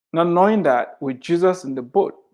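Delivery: ordinary talk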